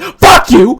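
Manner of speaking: sudden defiant yell; defiant outburst. Acted. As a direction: throw the insult out loudly. Lashing out after cheating.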